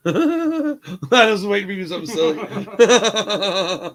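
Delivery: high-pitched, talking through laughter; laughing. Real, not acted. Man tells friend he awaited silliness, laughing as he talks. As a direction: crack up while getting the words out.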